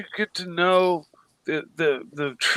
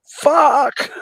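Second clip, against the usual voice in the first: sharp, higher, louder mock yelp of pain; pained curse; 10 semitones higher, 6 dB louder than usual. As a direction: yell it like a rock just hit your foot. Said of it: "I'm gonna go".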